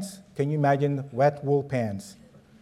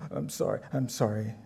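whimpering apology; 5 semitones lower, 8 dB quieter than usual. Retold or imitated. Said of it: "whimpering like a little baby".

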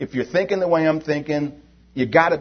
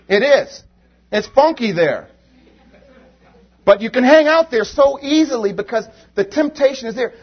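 higher, louder impassioned preaching; preacher warning the church about Egypt; 7 semitones higher, 6 dB louder than usual. Acted. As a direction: drive the warning home from the pulpit. Preacher builds to full force.

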